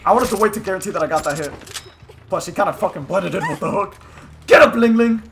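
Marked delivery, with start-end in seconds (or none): 4.5-5.2 loud, higher gloating call; taunting shout. Pretend. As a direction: shout down at a rival. Taunting downed opponent.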